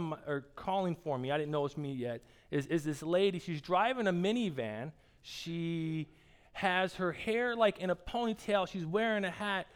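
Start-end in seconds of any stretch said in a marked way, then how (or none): none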